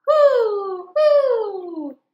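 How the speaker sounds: high loud hoots; owl hoot. Acted. Reading to kids, the owl hooting loud.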